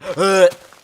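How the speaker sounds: gasp. Real, not acted. Sharp gasp at a remark.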